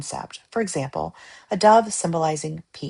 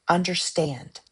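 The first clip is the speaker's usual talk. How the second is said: ordinary talk, nothing marked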